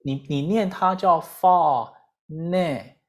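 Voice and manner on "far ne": three syllables with a clear gap between each; teacher's phonics sounding out. Shown as a demonstration. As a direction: sound out far, ne, mic as separate chunks. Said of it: "三个音节".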